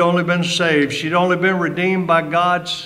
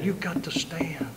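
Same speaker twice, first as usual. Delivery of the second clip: urgent whisper; 3 semitones higher, 8 dB quieter than usual. Real, not acted. Preacher urging the congregation to stand, hushed whisper. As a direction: drop to a whisper to press it home.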